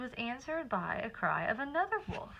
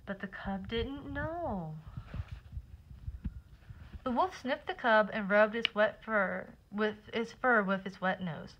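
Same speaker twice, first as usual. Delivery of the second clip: plain reading voice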